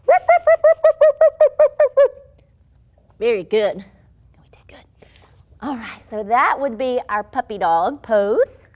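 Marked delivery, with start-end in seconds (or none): 0.1-2.1 loud, much higher yappy puppy "ruff" barks. Shown as a demonstration. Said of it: "let's hear some puppies". 3.8-5.1 whisper. Real, not acted. Praising kids after the exercise.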